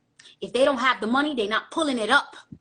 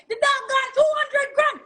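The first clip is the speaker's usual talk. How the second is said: DJ hype shout; 12 semitones higher than usual. Retold or imitated. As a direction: shout it out like a club DJ. DJ hyping a big spender.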